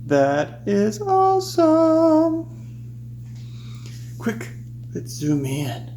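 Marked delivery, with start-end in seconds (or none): 0.0-2.5 high, slow, stretched-out wonder; awed delight. Real, not acted. Host marveling at swirling milk colors, high and slow. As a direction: let the amazement ring up high.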